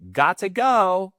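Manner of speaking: drawn-out leaving line; exaggerated exit. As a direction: announce your exit grandly. Leaving gossip dinner.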